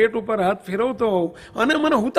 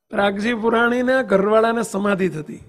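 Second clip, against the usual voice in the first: ordinary talk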